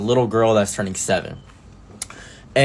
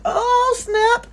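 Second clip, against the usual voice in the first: far higher mock surprise; oh snap. Reacting to news of the divorce, high mock surprise. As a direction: squeal it in fake shock.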